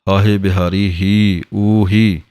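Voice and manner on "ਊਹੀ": ਊਹੀ said with a long uu; long uuhi. Shown as a demonstration. Teacher to students, modelling ਊਹੀ with a long uu. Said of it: "ਇਹਦੀ ਆਵਾਜ਼ ਊੜੇ ਦਲੈਂਕੜ ਦੇ ਨਾਲ ਹੈ ਊ".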